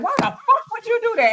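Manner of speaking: high-pitched retold frustration; frustrated outburst. Texting rant after getting frustrated, retold exasperated outburst. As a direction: vent it high, re-enacting an angry text. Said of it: "If I get frustrated".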